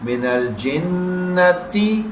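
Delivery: الجنتی slowly with a short clear i. Shown as a demonstration. Teacher showing the small ye sound slowly. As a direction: draw out من الجنتی, no long e. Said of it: "چھوٹی یہ کی آواز بنانی ہے".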